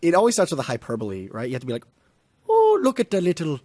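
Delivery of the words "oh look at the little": botched Scottish that comes out Irish; Irish accent. Acted. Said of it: "Yeah, that's Irish".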